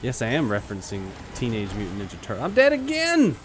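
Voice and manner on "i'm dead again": higher, louder frustrated cry; real exasperated shout; exasperated yell. Real, not acted. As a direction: yell it out in annoyance. Dies again, exasperated.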